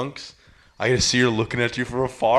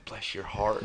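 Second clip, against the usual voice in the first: creepy whisper blessing; 3 semitones lower, 11 dB quieter, slower than usual. Retold or imitated. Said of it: "She's like".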